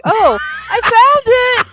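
high, hammed-up relieved cheer; staged relief for the customer; fake relief. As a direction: play it as overdone pretend relief. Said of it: "pretend like".

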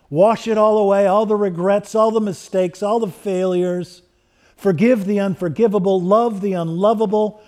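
fervent prayer. Acted. Preacher calling on Jesus to come, fervent swelling prayer. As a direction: pray it out with rising fervour, then settle.

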